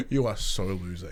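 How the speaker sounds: low, soft teasing jab; quietly mock the loser; mock taunt. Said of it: "I'm like".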